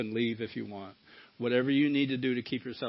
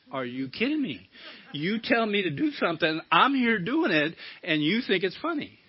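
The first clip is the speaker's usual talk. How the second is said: rising higher and louder, indignant retort; mad again; 7 semitones higher, 4 dB louder than usual. Retold or imitated. Man indignant at laughing spirits in meditation, rising anger. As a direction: build the outrage to a near shout. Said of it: "Then I got mad again".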